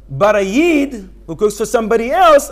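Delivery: ordinary talk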